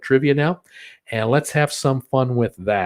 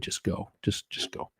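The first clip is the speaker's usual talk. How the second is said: whispered; 5 semitones lower, 12 dB quieter than usual. Real, not acted. Whispered advice to a cruise worrier.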